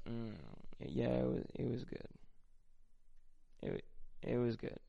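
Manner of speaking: soft, reluctant, hesitant praise; unconvinced. Shown as a demonstration. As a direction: say it's good while plainly not liking it. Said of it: "She knew that I didn't like it".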